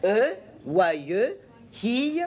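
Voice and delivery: waye, then a very high hi; syllable sounding. Shown as a demonstration. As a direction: build waye then jump up on hi. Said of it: "yod plus". (« waye » puis « hi » très aigu)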